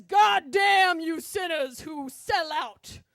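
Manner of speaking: fire-and-brimstone preacher, stretching the stressed words; booming sermon voice. Retold or imitated. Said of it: "continues his sermon".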